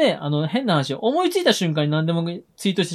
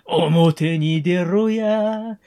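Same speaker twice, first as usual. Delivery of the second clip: slow, drawn-out yankee threats; japanese delinquent tough talk; 3 semitones higher, much slower than usual. Pretend. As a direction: chant it like a street punk. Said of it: "そうやって聞くとまあ面白いかな".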